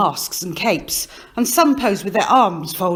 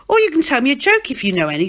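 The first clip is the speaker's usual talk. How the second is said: quick, louder nudge; pushy Tickle Peter; 6 semitones higher, 5 dB louder, much faster than usual. Acted. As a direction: hurry him into a joke. Peter urging Stan.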